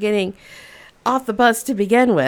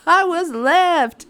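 high, slow, whiny insistence; upset kid; 9 semitones higher, 4 dB louder, slower than usual. Retold or imitated. Kid insisting to her mom, high and drawn out. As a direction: drag it out like a hurt child.

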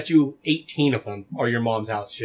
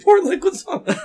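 laughing quote; 5 dB louder than usual. Acted. Repeating comment, laughing.

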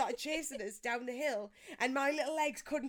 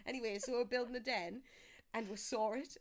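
ordinary talk

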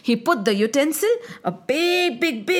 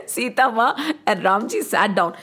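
ordinary delivery

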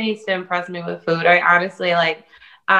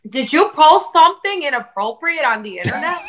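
accusing mom; 8 semitones higher, 4 dB louder than usual. Retold or imitated.

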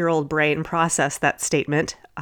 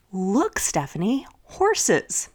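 really excited; 3 semitones higher, much slower than usual. Retold or imitated. Mom excitedly points out horses to her daughter. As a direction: point out the horses with eager delight. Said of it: "really excitedly".

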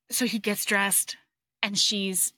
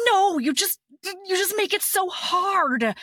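frustrated whine; 8 semitones higher than usual. Retold or imitated. Hero complaining to his wife in bed, high frustrated whine. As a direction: whine that she makes it hard.

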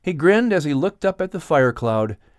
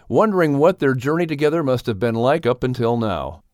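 usual speaking voice

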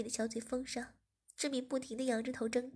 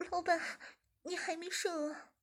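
put-on higher, timid girlish voice; young woman; 5 semitones higher than usual. A narrator's reading. Zhiming nervously questions the boss, higher voice. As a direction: ask nervously, voice lifted.

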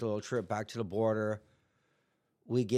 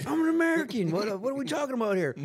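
much higher, squeaky outraged protest; mock indignant; 11 semitones higher, 3 dB louder than usual. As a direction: squeal your rights like a caught kid. Mock indignant claim to agent.

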